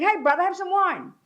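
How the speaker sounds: high, breezy offer of wine; tipsy sister offering wine, pitched high; sister's friendly offer. Retold or imitated. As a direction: sweet-talk the robber. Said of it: "Then Gianna kept saying".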